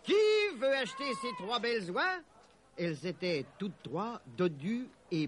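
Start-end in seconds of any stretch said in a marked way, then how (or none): 0.0-2.2 high market hawker's call; raised, carrying sales pitch; crying out. A narrator's reading. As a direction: hawk your geese to the crowd. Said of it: "cria Robin".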